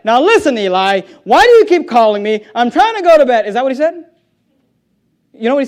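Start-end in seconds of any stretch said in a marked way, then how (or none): 0.0-3.5 loud, hammed-up complaint; exasperated mock protest, raised voice; mock irritation. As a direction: act fed up at being woken. Acts out an irritated child woken up.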